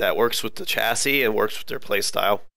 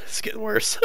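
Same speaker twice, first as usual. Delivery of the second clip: voice cracking; 13 semitones higher than usual. Real, not acted. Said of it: "The voice box is getting tired".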